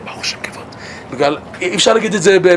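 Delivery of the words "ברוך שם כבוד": breathy whisper, much softer. Shown as a demonstration. Teacher showing how the verse is said, whispering. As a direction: whisper it like the quiet line in prayer. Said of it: "בגלל זה אומרים בשקט".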